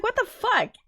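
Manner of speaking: high, loud incredulous what the fuck; baffled squeal. Real, not acted. Streamer reacting to the wild mood swings, high and incredulous. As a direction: squeak it out in disbelief.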